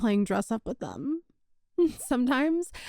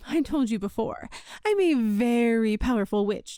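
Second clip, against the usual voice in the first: ordinary talk, nothing marked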